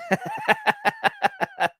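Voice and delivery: high burst of real laughter; laughing. Real, not acted. Reacting to chat joke.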